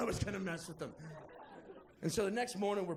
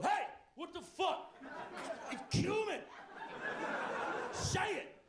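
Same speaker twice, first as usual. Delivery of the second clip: mock furious roommate; 11 semitones higher than usual. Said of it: "like clockwork".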